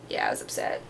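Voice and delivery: soft unvoiced mock-sad aside; whispered. Mock-sad whisper about the broken toy phone. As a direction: breathe it out like a pouting kid.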